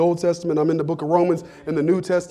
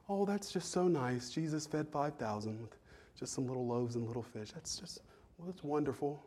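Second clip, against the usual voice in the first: mock casual; 6 semitones lower, 20 dB quieter than usual. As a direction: shrug it off like it's nothing special. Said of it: "like it's just casual".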